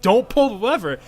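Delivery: loud, high frantic warning; group frantically begging the tank; panicked plea. Retold or imitated. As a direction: beg him not to, in a panic. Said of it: "They're like".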